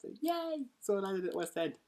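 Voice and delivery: high, loud and gleeful; excited. Real, not acted. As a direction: squeal with delight. Excited about Aladdin.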